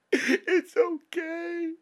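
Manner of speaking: wailing. Real, not acted. Tearful prayer, wailed take.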